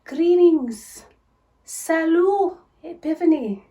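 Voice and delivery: high, loud hammed-up French diva; over-the-top French showgirl. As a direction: belt it out like a grand showgirl.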